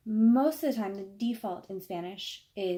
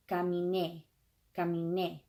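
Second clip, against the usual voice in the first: "caminé" with the stress on the final é; "caminé" said deliberately, landing on é; final-syllable stress. Shown as a demonstration. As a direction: punch the last syllable of "caminé". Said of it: "really hear me how I say caminé".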